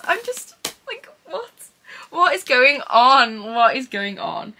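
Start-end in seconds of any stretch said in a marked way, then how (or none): 0.0-1.5 squeaky disbelief. Real, not acted. Stunned by gift. 2.1-3.4 louder and slow, each word stretched in amazement; stunned exclamation. Real, not acted. Staring at the gifted books, loud slow amazed outburst.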